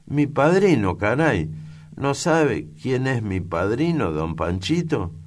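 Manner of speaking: high, loud and giddy; a silly, innocent country girl showing off; bright-eyed girl bragging about her godfather; naive laughing girl. A narrator's reading. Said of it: "Marcelina se ríe, boba e ingenua".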